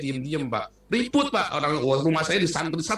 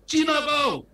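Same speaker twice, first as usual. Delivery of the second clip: a bully's jeering shout; retold taunting shout; 13 semitones higher, 4 dB louder, slower than usual. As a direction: yell it high and loud like a bully. Kampung bullies taunting the boy. (teriakan ejekan anak kampung, keras dan tinggi)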